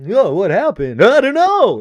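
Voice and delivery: selling the knockout, loud and high; hammed-up dazed. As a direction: overplay being knocked silly. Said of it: "And you gotta play it up".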